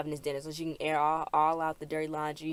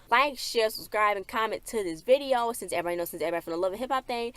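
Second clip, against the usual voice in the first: ordinary talk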